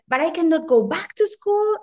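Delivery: high-pitched mock objection; husband protesting, pitched well up; imitating husband's protest. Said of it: "and he said".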